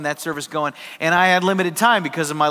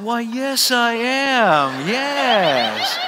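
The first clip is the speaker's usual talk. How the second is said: high, stretched-out mock politeness; hammed-up embarrassed yes, long vowels; sheepish admission; 5 semitones higher, much slower than usual. Caught pastor admits who he is.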